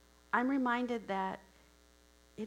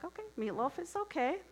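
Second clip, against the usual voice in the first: high-pitched, mildly hesitant going along; newcomer's high, obliging okay; polite acceptance; 9 semitones higher than usual. Acted. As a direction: pipe up high, agreeing to it. Boss politely accepts the meal.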